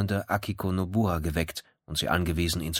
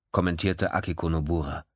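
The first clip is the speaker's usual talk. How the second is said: ordinary delivery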